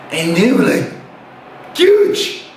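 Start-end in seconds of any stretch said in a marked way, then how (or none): 1.7-2.4 mock boast. Friends boast of their size.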